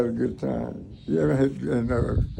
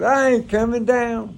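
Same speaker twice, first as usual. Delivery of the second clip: amazed; 9 semitones higher, 6 dB louder, slower than usual. Retold or imitated. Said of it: "I said".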